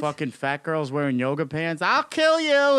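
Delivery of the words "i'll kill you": high, loud mock menace; squeaky fake threat. Mocking women's death threats.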